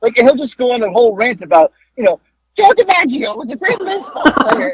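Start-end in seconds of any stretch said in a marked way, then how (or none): 2.6-4.7 much higher squeaky mock Howard. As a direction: squeak out the ramble like the friend. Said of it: "squeaky voice".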